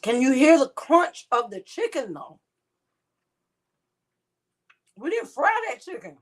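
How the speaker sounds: high, loud and thrilled; excited. Real, not acted. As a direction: marvel at the crunch. Excited while eating.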